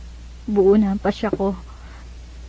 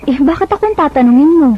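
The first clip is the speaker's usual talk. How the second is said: pitched up, louder, exasperated; indignant retort; 7 semitones higher, 5 dB louder than usual. Acted. Ivy to Vincent, annoyed he expects her to decide. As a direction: throw the question back at him, irritated.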